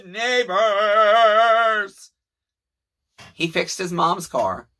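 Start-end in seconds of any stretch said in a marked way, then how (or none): 0.1-2.1 high, loud, drawn-out singsong; elated call to the neighbors; joyful announcement. Real, not acted. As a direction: announce the good news like a cheer. Happy announcement to viewers.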